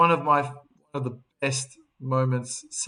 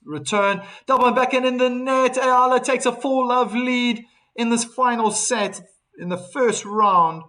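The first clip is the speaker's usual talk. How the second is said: excited play-by-play; 9 semitones higher, 7 dB louder than usual. Real, not acted. Live point call, rising excitement.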